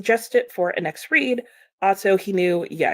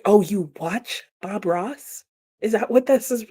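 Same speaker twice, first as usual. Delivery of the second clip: normal talking voice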